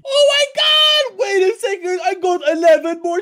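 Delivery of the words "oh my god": high, slow excited shout. Real, not acted. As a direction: shout it out in delight. Finds eleven diamonds, big surprise.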